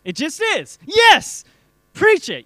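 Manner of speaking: high, very loud cry of approval; exultant shout. Real, not acted. Speaker cheers the answer he was waiting for, exultant shout. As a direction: burst out shouting like a fired-up preacher.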